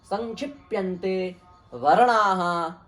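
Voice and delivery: measured, chanted Sanskrit definition; teacher intones the Sanskrit definition; Sanskrit recitation. Acted.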